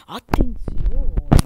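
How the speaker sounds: very slow loud attenzione; drawn-out warning. Real, not acted. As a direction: stretch it out tensely. Careful move in game.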